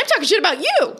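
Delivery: high-pitched mock outrage; indignant comeback. Host turning on a commenter, mock indignant. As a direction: throw it back in hammed-up indignation.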